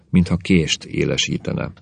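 ordinary delivery

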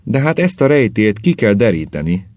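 plain reading voice

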